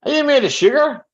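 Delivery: squeaky teasing quote from a mate; high mock taunt. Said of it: "he would say to me".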